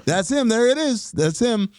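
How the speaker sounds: high, quick bursts of spotting him; acted-out pleased discovery; delighted recognition. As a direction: keep pointing him out, pitched well up. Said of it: "these little glimpses like".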